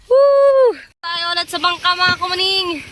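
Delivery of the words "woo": loud, high whoop of joy; excited whoop. Real, not acted. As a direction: cheer out loud. Cheering on the boat.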